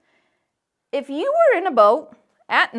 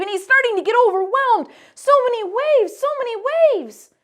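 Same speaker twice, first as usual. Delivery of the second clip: rising alarm; 9 semitones higher, 6 dB louder than usual. A narrator's reading. Peter overwhelmed by storm.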